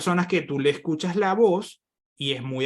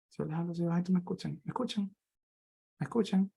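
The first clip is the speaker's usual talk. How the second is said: barely audible, much softer; very quiet demo; 3 semitones higher, 8 dB quieter, faster than usual. Shown as a demonstration. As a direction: drop the voice to almost nothing.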